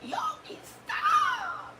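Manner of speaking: high squealing mock cry of Yogi, stop; a hammed-up tearful wife begging; mock crying plea. Wife mocking her own crying at her husband, high squeal.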